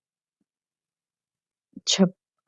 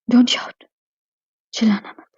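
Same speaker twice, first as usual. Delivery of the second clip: whisper. Acted. Hushing her in the dark.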